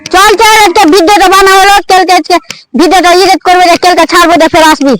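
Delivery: loud, projected instructions; forceful outdoor calling to the team; director wrapping up. Acted. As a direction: send the crew off in a big voice. Director wraps up the shoot.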